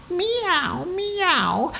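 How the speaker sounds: very high little cat mews; pretend-scared meowing. A narrator's reading. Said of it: "still pretending to be scared".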